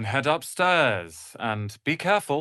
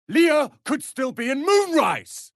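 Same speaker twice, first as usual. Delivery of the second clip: alarmed; 5 dB louder than usual. Acted. Worried about missing friend.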